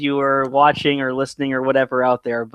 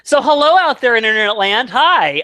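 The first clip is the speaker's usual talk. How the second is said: cheery greeting; 8 semitones higher, 6 dB louder than usual. Real, not acted. Greeting stream audience while waiting.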